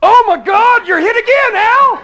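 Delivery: very high, loud panicked shout; horrified cry. Acted. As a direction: wail in alarm for your friend. Companion sees second arrow hit.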